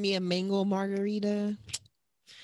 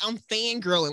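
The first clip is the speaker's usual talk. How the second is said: ordinary delivery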